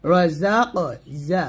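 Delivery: "জা" with a buzzing, bird-like sibilant; whistling z. Shown as a demonstration. Teacher showing safir, whistling buzz on "জা". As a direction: make the z of "জা" ring and buzz. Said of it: "পাখির মতো এখানে আওয়াজ বের করে উচ্চারণ করতে হবে".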